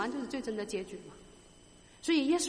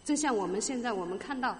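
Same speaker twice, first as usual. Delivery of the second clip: ordinary talk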